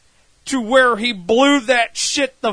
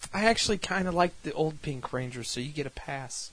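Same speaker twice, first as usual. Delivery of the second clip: very quiet, low, quick aside; low confiding aside; 9 semitones lower, 19 dB quieter, faster than usual. Acted. Villain privately lets the Pink Ranger off, low confiding. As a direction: drop it low as a private admission.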